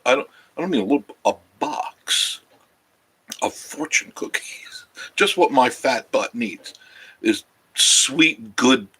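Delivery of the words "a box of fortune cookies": breathy whisper, barely voiced; whispered aside. Real, not acted. Confessing his fortune cookie box to viewers, whispered. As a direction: drop to a secret whisper.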